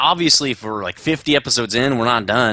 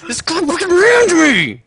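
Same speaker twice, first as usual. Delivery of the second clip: higher, louder, slow outraged rant; outraged yell; 7 dB louder, slower than usual. Real, not acted. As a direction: yell at the guy who rammed you. Rammed in game, venting.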